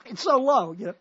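high, loud, squeezed phone voice; tinny phone sound. Shown as a demonstration. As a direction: sound like a bad cellphone speaker. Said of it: "Only it sounds like this".

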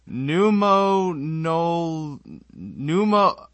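pneumo- sounded out loudly in halting pieces; sounding out. Real, not acted. Reader tries an unknown disease name, sounding out "pneumo-" in pieces. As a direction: stumble through "pneumo-", stretching each part. Struggling to read a long word.

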